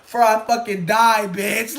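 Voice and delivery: very loud, high, slow yell; loud yelled quote. Retold or imitated. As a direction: scream it at him. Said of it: "I'm like".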